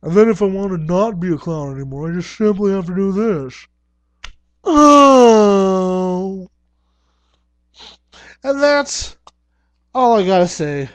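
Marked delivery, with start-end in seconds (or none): 4.6-6.5 loud high yelp as nose rips off; pained yelp. Acted. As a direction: cry out from the sting. Pulls nose off again.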